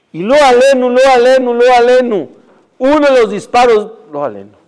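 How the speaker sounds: dramatic. A narrator's reading. Dramatic gunfire climax.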